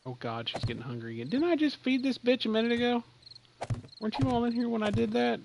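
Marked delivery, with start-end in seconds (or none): 1.3-5.4 high, louder exasperated complaint; can you believe this creature. Real, not acted. Exasperated at hungry creature, asking the room.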